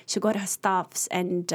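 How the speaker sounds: ordinary talk, nothing marked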